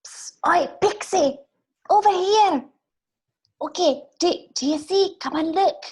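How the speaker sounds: high-pitched, urgent character voice; urgent prisoner. Acted. Prisoner beckons the pixie from next cell, urgent high voice. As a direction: beckon someone over in a high, accented voice. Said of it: "is frantically trying to say".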